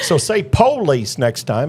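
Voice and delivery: slow, drawn-out Southern POlice; Southern police advice. Retold or imitated. A local coaching the cop to sound Southern, in a slow drawl. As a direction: stress the first syllable of police, Southern style. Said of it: "No, you sound like a damn Northern hick".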